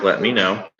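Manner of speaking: ordinary talk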